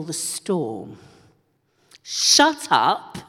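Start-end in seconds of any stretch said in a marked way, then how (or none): 2.0-3.2 northern telling off. Shown as a demonstration. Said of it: "a proper northern telling off".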